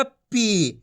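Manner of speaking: पी with a drawn-out ee; long ī. Shown as a demonstration. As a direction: stretch the vowel of पी. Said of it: "खींच के पढ़ेंगे".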